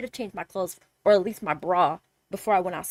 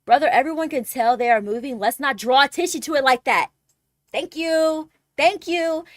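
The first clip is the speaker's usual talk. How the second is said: imagined retort; 4 semitones higher, 7 dB louder than usual. Pretend.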